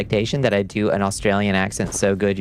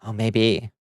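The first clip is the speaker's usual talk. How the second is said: ordinary delivery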